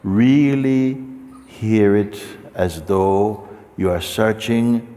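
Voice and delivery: ordinary talk, nothing marked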